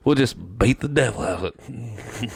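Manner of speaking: soft, gentle, pleased; soft quiet chuckling voice; Bob Ross impression. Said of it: "he fucking grins".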